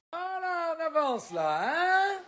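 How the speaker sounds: high cooing voice. Real, not acted. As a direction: softly coo at a little one. To toddler.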